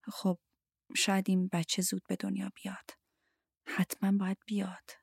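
hushed, almost whispered wistfulness; wistful, downhearted. A narrator's reading. Harriet hopes the baby comes early, downhearted. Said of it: "با دلتنگی گفت".

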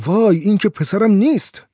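loud, high-pitched shriek of alarm; horrified cry. A narrator's reading. Madame finding a stranger instead of her son, crying out in horror. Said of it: "مادام با وحشت فریاد زد".

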